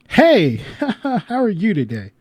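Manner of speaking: slow, sugary hello on the phone; butter her up as she answers; sweet phone greeting. Real, not acted. Calling the kids' mother, sugary slow hello.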